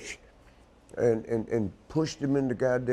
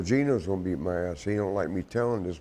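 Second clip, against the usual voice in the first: ordinary talk, nothing marked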